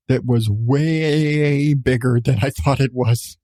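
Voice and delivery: ordinary talk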